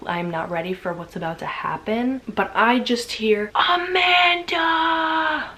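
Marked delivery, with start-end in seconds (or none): 3.5-5.5 high, stretched-out yell of a name; mock chef scream. The chef yelling Amanda's name across the kitchen. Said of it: "she's screaming".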